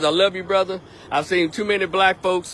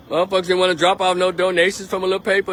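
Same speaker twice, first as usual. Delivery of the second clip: ordinary talk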